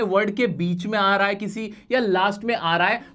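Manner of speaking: ordinary talk, nothing marked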